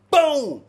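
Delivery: loud high bang; loud boom. Retold or imitated. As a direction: slam it out. Kicking door.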